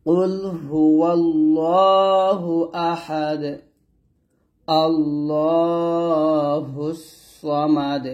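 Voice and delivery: slow melodic tilawat of কুল হুয়াল্লাহু; teacher reciting for students to repeat; Quran recitation. Shown as a demonstration. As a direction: recite it slowly with a chanting tune. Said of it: "আবার".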